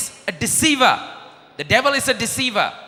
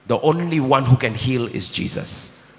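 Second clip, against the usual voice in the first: ordinary delivery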